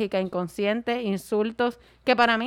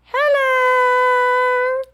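long drawn-out, high, loud "hello"; dramatic hello; 13 semitones higher, 8 dB louder than usual. Pretend. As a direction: stretch the greeting like a diva. Reading fan letter, dramatic opener.

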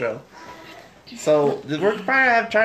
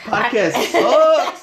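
mock complaint; 9 semitones higher, 6 dB louder, much slower than usual. Host mock complaint, laughing.

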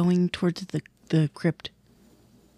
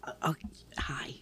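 whispering; 6 semitones lower, 10 dB quieter, slower than usual. Acted. Sneaking party meets quietly.

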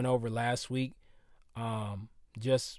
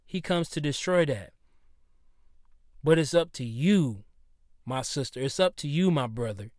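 preaching; 3 semitones higher, 5 dB louder than usual. Acted. Rising into full preaching.

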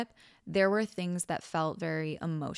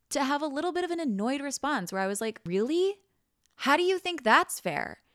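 higher, a touch of irritation in "Really? How do you think that's fair?"; mild annoyed tone; 8 semitones higher than usual. Retold or imitated. Imitating her own mild annoyance at her boyfriend. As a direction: ask it lightly put out, quicker. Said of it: "the extent of my annoyed tone".

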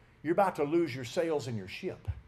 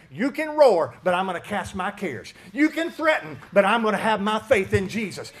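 defiant declaration; 3 semitones higher, 9 dB louder than usual. Retold or imitated. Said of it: "and you say".

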